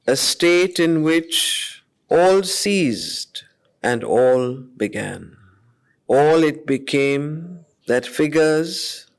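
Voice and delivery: slow, measured reading of epic verse; solemn poem reading with long pauses; poetry recitation. Acted. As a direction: let each line of the poem hang in the air. Recites verse passage slowly.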